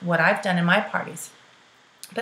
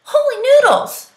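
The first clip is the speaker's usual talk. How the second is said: excited exclamation; 8 dB louder than usual. Retold or imitated. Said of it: "she said".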